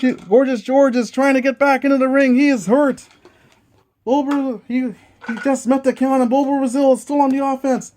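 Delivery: wrestling commentator. Acted. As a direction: hype every kick-out like a TV announcer. Play-by-play of dice wrestling match.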